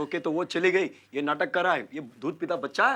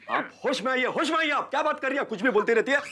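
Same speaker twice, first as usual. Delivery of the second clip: raised, agitated protest; higher-pitched scolding, outraged; indignant; 8 semitones higher than usual. Acted. Husband rebukes woman over her wild claim, indignant.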